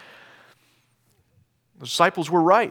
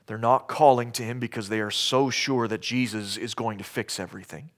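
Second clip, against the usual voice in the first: normal talking voice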